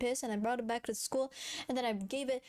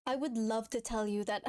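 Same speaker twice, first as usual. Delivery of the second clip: normal talking voice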